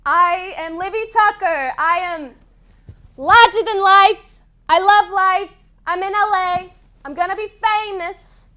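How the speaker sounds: loud boastful self-talk; big self-talk. Shown as a demonstration. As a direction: pump yourself up out loud. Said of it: "Do some self-talk".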